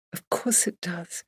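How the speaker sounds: soft and slow, barely voiced; whisper. Emily consoling Barbara, whispered. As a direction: breathe the comfort into her hair. Emily soothing her grieving friend.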